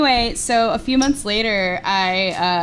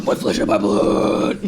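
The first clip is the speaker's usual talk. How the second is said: raspy whisper; 13 semitones lower, slower than usual. Acted. Echoing mock Jesus.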